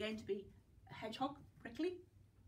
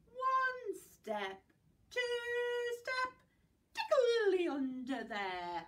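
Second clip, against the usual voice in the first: playful rhyme, rising to a squeal; nursery rhyme; 4 semitones higher, much slower than usual. Acted. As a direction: act it out for toddlers.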